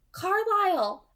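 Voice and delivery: bright high call of a name; eager female voice. A narrator's reading. Alice answering the phone to Carlisle, high eager voice.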